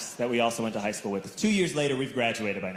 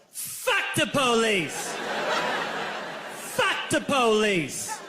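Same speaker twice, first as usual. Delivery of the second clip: high, drawn-out chanting yell; 5 semitones higher, much slower than usual. Retold or imitated. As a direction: stretch each word into a slow, high holler. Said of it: "I yelled".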